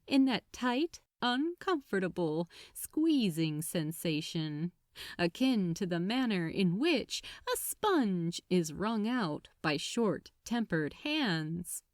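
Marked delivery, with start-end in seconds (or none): none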